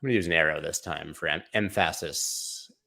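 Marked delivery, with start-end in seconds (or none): 1.5-2.7 "emphasis" slowly as em-FASS-is; wrong-syllable stress. Acted. Streamer jokes about arrow, "emphasis" stressed as em-FASS-is. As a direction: stretch "emphasis", leaning on the second syllable, em-FASS-is. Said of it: "put the emphasis on the wrong syllable".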